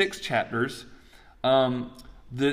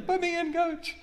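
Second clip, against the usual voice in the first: much higher, excited squeaky volunteer; a keen player begging the coach; eager Isaiah. Retold or imitated. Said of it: "And Isaiah goes".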